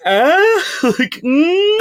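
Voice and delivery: high-pitched "ah?" and "mm"; playful questioning noise. Acted. Host acting out a teasing text reply, playful high noises.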